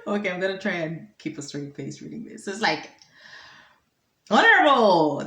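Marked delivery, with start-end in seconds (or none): 4.3-5.2 loud, higher-pitched eager hail; reporter greeting. Acted. Reporter phones the minister, loud hailing greeting. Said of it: "this is the reporter now".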